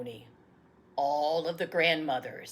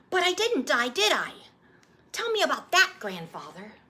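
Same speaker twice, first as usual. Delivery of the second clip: put-on high, eager child voice; a little boy pleading for the story; young boy; 9 semitones higher, 7 dB louder, faster than usual. Boy asking his grandfather to retell his birth, eager child voice.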